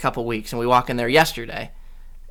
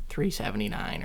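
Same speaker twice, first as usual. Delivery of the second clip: whispered price; 4 semitones lower, 11 dB quieter than usual. Retold or imitated. Cashier quietly states price.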